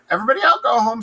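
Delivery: flustered dismissal. Acted. Lady Macbeth sending guests home, high flustered call. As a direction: shoo them all out, mock hostess.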